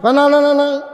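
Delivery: high, excited dialogue in sermon voice; high-pitched preaching. Real, not acted. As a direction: act out the couple at a high pitch. Preacher in full cry.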